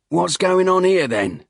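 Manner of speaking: pry with a loud Cockney grin; loud Cockney hiker. Acted. Hiker pesters the instructors in a loud Cockney voice.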